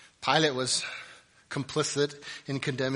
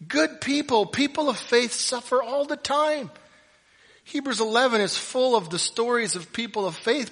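high, loud, driving sermon voice; fiery preaching; 6 semitones higher, 7 dB louder than usual. Acted. Pastor pressing Hebrews eleven on his listeners, fiery and loud. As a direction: preach it hard to the back row.

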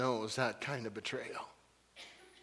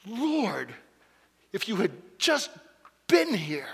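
reproachful; 7 semitones higher, 5 dB louder than usual. Acted. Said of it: "some of you have said those words".

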